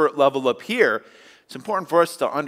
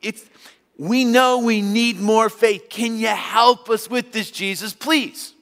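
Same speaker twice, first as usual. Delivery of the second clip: pleading disciples; 7 semitones higher, slower than usual. Retold or imitated. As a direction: beg Jesus earnestly, stretching the words. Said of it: "It's just kinda like".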